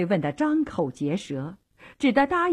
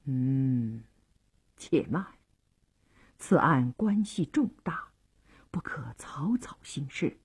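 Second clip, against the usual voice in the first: low, hushed inner monologue; soft, private deliberation; thinking to oneself; 7 semitones lower, 9 dB quieter than usual. A narrator's reading. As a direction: weigh it up quietly, trailing into near whisper. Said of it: "但又转念想到".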